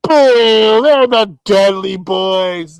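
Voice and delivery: loud, slow jeering fan shout; mock crowd boo. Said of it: "It's like".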